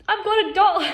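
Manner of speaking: high, loud burst of disbelief; real laughing realization. Real, not acted. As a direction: blurt it out, half laughing at yourself. Laughing at her own realization.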